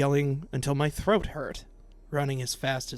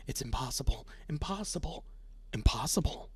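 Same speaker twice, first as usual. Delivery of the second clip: whispered disbelief; 5 dB quieter than usual. Acted. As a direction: whisper it, voiceless and hushed.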